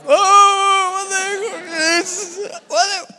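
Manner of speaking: very high, drawn-out comic sobbing; mock wailing. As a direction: wail your disappointment in a squeal. Comic wail at unwanted answer.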